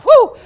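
high, very loud "woo"; excited whoop. Retold or imitated. Preacher voicing his spirit stirring, excited whoop. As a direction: whoop as if the fire just hit. Acted excitement at fire coming.

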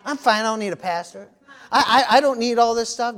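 preacher lightly mimicking a churchgoer who says he needs no pastor; mocking a stubborn churchgoer. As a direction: brush it off like someone too proud for church. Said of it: "people have that in their head sometimes".

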